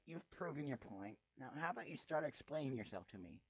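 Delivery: usual speaking voice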